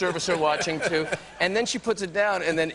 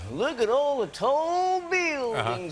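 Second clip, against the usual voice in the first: much higher, slow, wide-eyed tourist voice; gawking tourist; 8 semitones higher, slower than usual. Retold or imitated. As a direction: gape up at the skyscrapers. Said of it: "you know, kinda".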